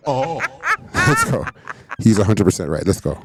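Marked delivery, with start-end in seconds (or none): 0.1-1.4 laughing cheer. Real, not acted.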